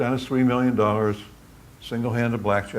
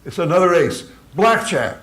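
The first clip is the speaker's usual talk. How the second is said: excited announcement; 6 semitones higher, 7 dB louder than usual. Retold or imitated. Punchline build.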